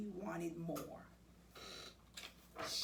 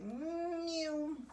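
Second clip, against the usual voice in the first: high, sly cat voice; a smug cat's reply; high-pitched cheeky cat voice; Kitty's answer; 7 semitones higher than usual. Acted. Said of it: "And Kitty said".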